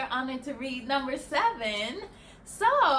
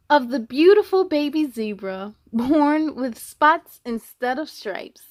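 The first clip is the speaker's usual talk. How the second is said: louder, lively storybook narration; reading to children; 8 dB louder than usual. A narrator's reading. Reading the zebra story to kids, lively and louder. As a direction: tell it brightly to the little ones. Reads the picture book aloud.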